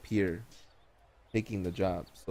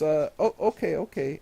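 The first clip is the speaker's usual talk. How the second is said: flustered; 7 semitones higher, 3 dB louder than usual. Acted. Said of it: "he says".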